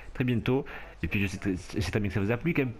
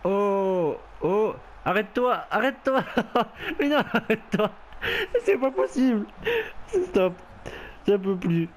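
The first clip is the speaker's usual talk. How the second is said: exasperated yelling; 9 semitones higher than usual. Real, not acted. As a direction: shout at the screen to make him stop. Player yelling at runaway player.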